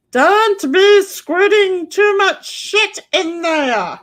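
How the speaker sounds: high, slow, drawn-out holler; mock scolding shout. Put on just for Bobby, mock scolding shout. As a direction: holler it like an over-the-top telling-off.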